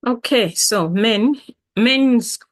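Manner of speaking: normal talking voice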